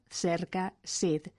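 c of cerca and Cid said as ts; old Castilian cerca, Cid. Shown as a demonstration. Showing old cerca and Cid, T joined to S. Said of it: "La T forma un solo sonido con la S".

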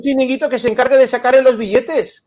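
high, loud, scornful rant; mocking indignation. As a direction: scoff at the scheme loudly. Mocking the politicians' scheme. (burla indignada y aguda)

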